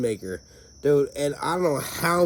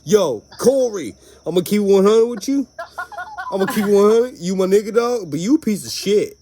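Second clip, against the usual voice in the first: imitating Boogie; 6 semitones higher, 7 dB louder than usual. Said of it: "He used to tell me that".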